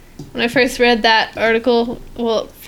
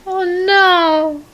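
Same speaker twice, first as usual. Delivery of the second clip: mock dismay; 7 semitones higher, 4 dB louder, slower than usual. Real, not acted. Hears how her friend broke down.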